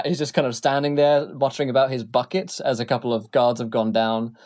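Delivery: normal talking voice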